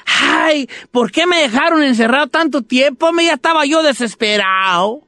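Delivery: high-pitched, delighted tree voice; eager little tree. Acted. Tree greets the children, eager and high. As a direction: greet loudly, then complain with relief.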